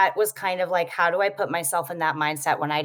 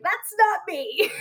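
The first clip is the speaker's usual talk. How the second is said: startled exclamation; 13 semitones higher, slower than usual. Retold or imitated. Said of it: "and I was like".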